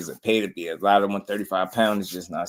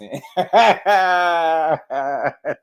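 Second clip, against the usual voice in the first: loud shrieking laughter; 8 semitones higher, 5 dB louder than usual. Real, not acted. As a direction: howl with laughter. Cracking up at own impression.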